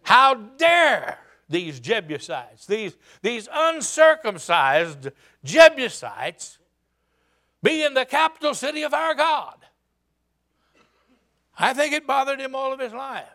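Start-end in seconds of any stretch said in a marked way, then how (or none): 0.0-9.6 slow, heavy, outraged rise to a high cry; indignant preacher voicing David's outrage; fuming indignation. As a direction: seethe at the intruders, then cry out. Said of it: "he started fuming".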